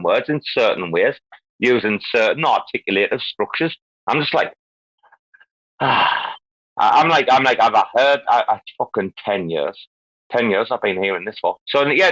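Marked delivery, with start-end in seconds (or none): 5.8-6.3 long breathy sigh; exasperated sigh. Real, not acted. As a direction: let out a weary sigh. Exasperated sigh amid rant.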